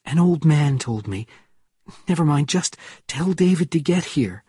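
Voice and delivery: anxious urgency. A narrator's reading. Anxious phone call after danger.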